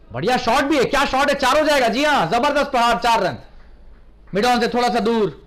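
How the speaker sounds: high, loud, thrilled call of a boundary; excited cricket commentary. Real, not acted. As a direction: shout the four with rising excitement. Boundary hit in live commentary.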